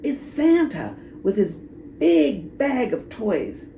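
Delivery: ordinary delivery, nothing marked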